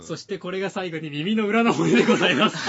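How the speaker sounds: loud quote dissolving into laughter; laughing as the punchline lands. Retold or imitated. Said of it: "って言われて".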